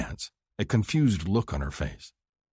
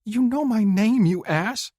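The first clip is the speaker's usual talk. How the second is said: high, loud indignant snap; offended, telling him off; 6 dB louder than usual. Acted. Woman scolding her lover for calling her female, indignant.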